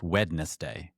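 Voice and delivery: "Wednesday" slowly as WED-nes-day, every letter sounded; three syllables in "Wednesday", the d pronounced; spelling pronunciation. Shown as a demonstration. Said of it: "because written, it's".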